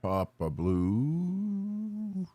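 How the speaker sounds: high, very slow sung-out name; long sing-song greeting. Real, not acted.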